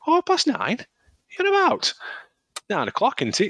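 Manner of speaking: very high, squeaky mock disbelief; acted-out astonishment, voice leaping right up; incredulous. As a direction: question it as if you can't believe it. Acts out his disbelief at start.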